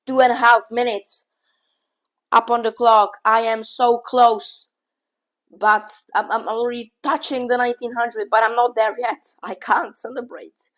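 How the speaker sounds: loud, thrilled shout about the clock; so close he can barely hold it in; excited shouting. Real, not acted. Streamer nearing nineteen hundred, shouting excitedly to chat.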